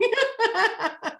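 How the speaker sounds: high squeaky groundhog squeal; groundhog impression. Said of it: "was that your groundhog, uh, impression".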